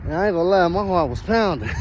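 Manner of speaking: ordinary delivery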